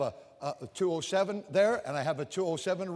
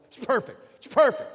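emphatic; 6 semitones higher, 9 dB louder than usual. Real, not acted. Preacher building emphasis on perfect.

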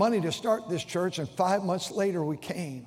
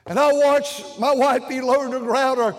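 full-force preaching; 4 semitones higher, 6 dB louder than usual. Acted. Pastor warning his congregation that life changes, preaching at full force. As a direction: preach it hard, pushing every phrase.

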